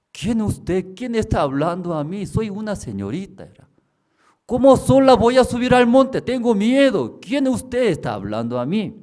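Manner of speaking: high, loud, scared questions; woman afraid to climb the hill, high and loud; frightened woman. Retold or imitated. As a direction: ask the dark who is there.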